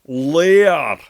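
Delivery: "léar" spoken loud and high, marked Gaelic vowel; Gaelic word léar. Shown as a demonstration. Teacher contrasting "léar" for learners, loud and clear. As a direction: model "léar" crisply for the class. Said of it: "from the word".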